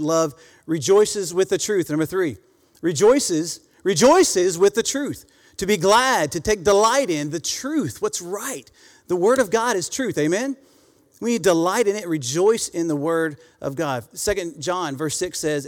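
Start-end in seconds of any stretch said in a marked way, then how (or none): 2.8-14.0 raised, louder, slower sermon build; higher, louder, slower pulpit fervor; preaching. Acted. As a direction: lift the congregation with joy. Preaching at rising intensity.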